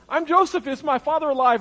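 high, loud mock outburst; excited reveal. Joseph revealing himself to his brothers, in a high, excited outburst.

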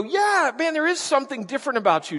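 high-pitched, casual wonder; impressed believer. Retold or imitated. New believers talking to Jesus, mock impressed. Voicing crowd starting to believe.

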